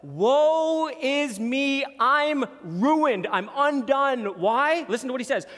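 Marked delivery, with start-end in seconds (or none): 0.0-4.4 high, loud, slow cry of despair; anguished cry. Acted. Prophet Isaiah cries out before God's throne in anguish. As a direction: wail it out like a man undone before God. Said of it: "He cries out".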